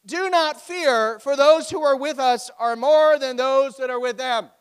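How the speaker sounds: loud, high, commanding scripture reading; emphatic proclamation. Acted. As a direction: proclaim the reassurance with full force. Prophet's answer read out with force.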